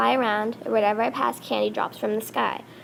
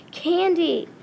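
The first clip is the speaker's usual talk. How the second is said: high, raised cry of a name; storybook scream; 7 semitones higher, 4 dB louder than usual. A narrator's reading. Captured monster crying out the betrayer's name, contained scream. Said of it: "I screamed".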